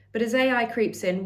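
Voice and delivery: ordinary talk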